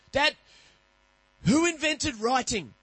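put-on high, slow little boy asking; child's question. Son asking dad at bedtime, high slow child voice. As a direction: drag out the curious kid's question.

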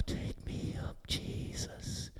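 barely audible, unvoiced whisper. Still small voice to her, whispered. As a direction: whisper it slowly like a voice in the ear. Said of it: "The voice said".

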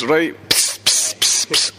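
tipsy sipping noises; mock drinking sounds. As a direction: imitate lads cracking open cans. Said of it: "Scots doing it as".

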